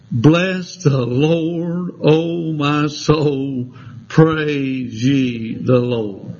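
very slow, low, drawn-out praise; reverent scripture reading. Acted. Preacher reading the closing praise to the church, very slow. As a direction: stretch each word out in reverence.

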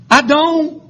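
preaching from level to a high shout; building sermon. Acted. Preacher thanking God for the unspeakable gift, rising to a shout. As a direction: climb to a full-throated shout on the gift.

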